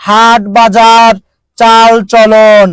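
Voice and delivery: slow, deliberate listing of words; teacher drawing out each example word; slow teaching demo. Shown as a demonstration. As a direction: spell each word out slowly for the class. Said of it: "যেমন দেখেন".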